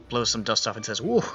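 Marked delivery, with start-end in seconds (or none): 1.0-1.2 put-on lively Jamaican-accented old librarian. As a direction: give it a Jamaican lilt. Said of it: "I really just wanted to do a Jamaican accent".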